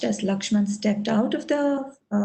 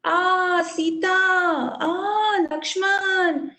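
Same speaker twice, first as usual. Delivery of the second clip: dying shout; 8 semitones higher, much slower than usual. Acted. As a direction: cry out the names as you die. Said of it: "Marich started shouting".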